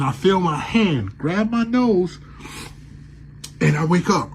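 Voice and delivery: normal talking voice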